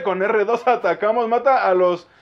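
normal talking voice

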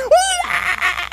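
high, loud imitated infant wail; loud baby wail. Imitating baby crying at night.

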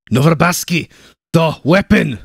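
loud, high urgent call; urgent shout. A narrator's reading. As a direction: shout the warning over the gunfire. Urgent battle calls.

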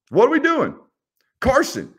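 high, incredulous call-out; exasperated. Real, not acted. Roasting players on the sideline.

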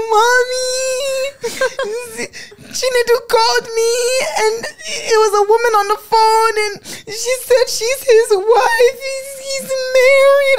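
high-pitched, loud mock sobbing. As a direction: wail it to mother through exaggerated tears. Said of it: "I'm, like, sobbing".

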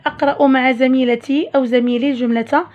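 ordinary talk

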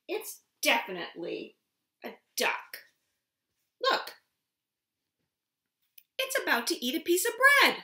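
lively storybook voice, high and loud; reading to a toddler. Acted. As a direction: read it big for little ones. Reads picture book aloud.

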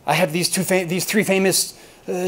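usual speaking voice

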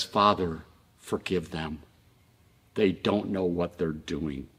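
soft, low prayer for mercy; quiet plea. Retold or imitated. Jesus to his Father from the cross, quietly pleading. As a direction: plead gently, low and hushed, with pauses.

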